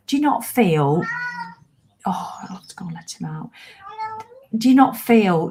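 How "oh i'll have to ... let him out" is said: half-voiced whisper to herself, much softer; a quick hushed note to self; whispered aside. Real, not acted. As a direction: mutter it under your breath. Aside about the cat.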